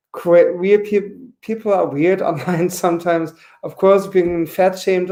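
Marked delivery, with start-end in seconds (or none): none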